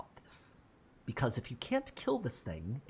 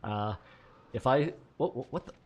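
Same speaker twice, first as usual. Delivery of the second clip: usual speaking voice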